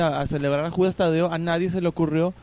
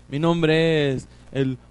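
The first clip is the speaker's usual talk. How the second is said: usual speaking voice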